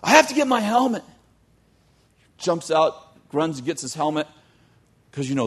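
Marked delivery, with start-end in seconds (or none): 0.0-1.0 high, loud desperate cry; frantic yelling. Acted. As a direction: shout it like he'll die without it. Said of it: "he starts yelling".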